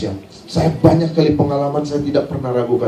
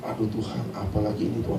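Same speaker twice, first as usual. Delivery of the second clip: low, soft aside to God; muttered prayer; 6 semitones lower, 13 dB quieter than usual. Retold or imitated. Guitarist wondering to God what this is now, muttered prayer. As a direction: retell it as a private, worried mutter.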